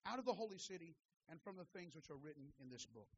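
quick, soft reading from the Bible; quiet, rapid recitation of scripture. Real, not acted. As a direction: read the verse briskly, voice sinking toward the close. Reads scripture aloud.

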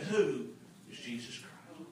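very low, soft question; near-murmured, low puzzlement; hushed quote. Retold or imitated. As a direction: drop right down for the stunning question. Stunned retelling of the question.

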